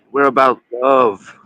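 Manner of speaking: imitating them, slow and emphatic; mock slogan. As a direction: stretch it out like a mocked slogan. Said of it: "They're always like".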